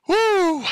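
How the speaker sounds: sharp high loud whoop of breath; exaggerated whooping inhale; mock gasp for air. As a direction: suck in air with a hammed-up whoo. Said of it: "Sucking in that oxygen".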